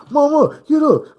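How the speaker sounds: high, loud pleading to wake her; urgent cry. Retold or imitated. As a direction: shake her awake, desperate. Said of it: "We in there say".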